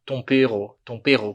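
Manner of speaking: French chef's "tompero" with throaty R; French-accented Portuguese, R in the throat. Retold or imitated. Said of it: "Porque se fala r na França".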